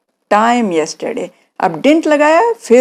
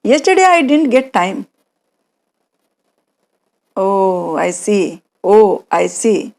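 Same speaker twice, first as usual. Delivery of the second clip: acted dialogue; much slower than usual. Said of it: "कोई मेरे पास आया है और वो मुझसे बोल रहा है".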